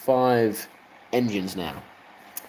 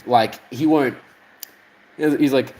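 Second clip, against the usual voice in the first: ordinary delivery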